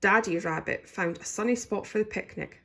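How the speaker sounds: ordinary delivery, nothing marked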